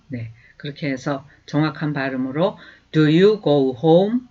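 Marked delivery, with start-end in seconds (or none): 2.9-4.2 slow, clear, the end of "home?" lifted up; slow rising yes/no question. Shown as a demonstration. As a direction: raise the tail of the question. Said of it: "올려야 되죠. 뒤끝을".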